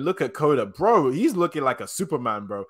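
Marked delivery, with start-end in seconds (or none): none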